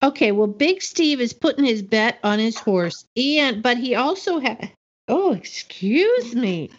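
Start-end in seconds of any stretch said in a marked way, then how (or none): 5.1-6.7 just swallowed a sip; talking right after a sip; while sipping a drink. Real, not acted. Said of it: "I'm drinking from my new Gone with the Bushes".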